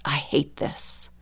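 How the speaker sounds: slow, hushed muttering to oneself; internal self-talk. Acted. Mom's inner conversation with herself, slow and hushed. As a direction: murmur it very slowly, as a private thought. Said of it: "my internal conversation with myself".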